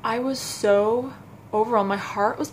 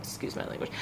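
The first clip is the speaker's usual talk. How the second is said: very soft, almost whispered apology; hushed aside; 12 dB quieter, faster than usual. Real, not acted. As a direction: drop right down for a quick apology.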